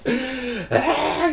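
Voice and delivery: long drawn-out high huff of defiance; angry "ei!". Retold or imitated. Preacher imitating himself defying God, drawn-out huff. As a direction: let out a stretched, indignant "ei". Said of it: "막 삿대질을 하면서 ... 대들었을 텐데".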